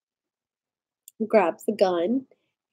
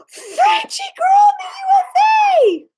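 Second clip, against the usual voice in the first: excited shout; 8 dB louder than usual. Real, not acted. Greeting a friend on stream.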